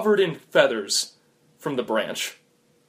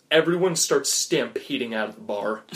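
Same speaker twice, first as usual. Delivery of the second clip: ordinary delivery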